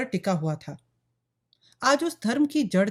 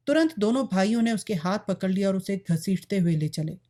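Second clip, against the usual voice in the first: usual speaking voice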